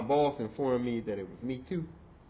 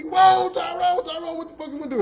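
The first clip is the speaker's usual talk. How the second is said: coworkers' alarm; 13 semitones higher, 10 dB louder than usual. Retold or imitated. Coworkers calling to Tyrone, a high, loud alarm. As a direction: voice the stunned crowd.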